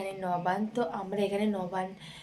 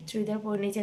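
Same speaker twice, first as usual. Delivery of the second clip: normal talking voice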